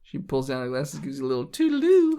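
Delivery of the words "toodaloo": flirty toodaloo. Acted. GM voices flirty Peggy.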